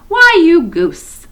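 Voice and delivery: high, loud and slow mock scold; teasing scold. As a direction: tease her little sister. Susan scolds Lucy teasingly.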